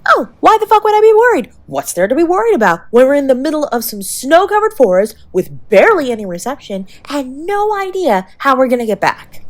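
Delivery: high, fast exaggerated sarcasm; sarcastic. Real, not acted. Sarcastic to the injured partner lost in the snowy forest. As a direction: overplay the fake calm. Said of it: "Of course it's sarcasm".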